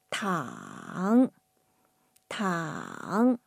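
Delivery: 躺 starting low, dipping down then rising back up; teacher modeling 躺 with a slow dip and rise; dipping third tone. Shown as a demonstration. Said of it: "It's a dipping tone. Down first and then up".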